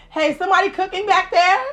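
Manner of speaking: high, loud holler; calling out. Real, not acted. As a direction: shout to someone in the back. Calls out to back of studio.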